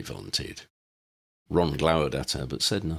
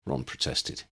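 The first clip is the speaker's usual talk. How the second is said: plain reading voice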